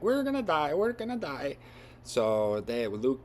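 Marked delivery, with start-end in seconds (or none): 0.0-1.6 high, loud panicked wail; panicked robot. Retold or imitated. Gold robot freaking out under fire, high panicked wail. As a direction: squeal in mock panic. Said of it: "freaking out at this point".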